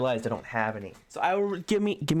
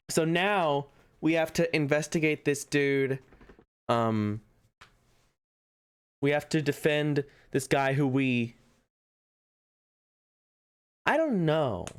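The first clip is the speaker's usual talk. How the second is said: usual speaking voice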